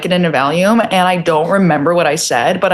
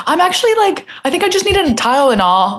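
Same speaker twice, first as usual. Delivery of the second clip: high whiny quote; 6 semitones higher than usual. Retold or imitated. Retold reply to mom, high.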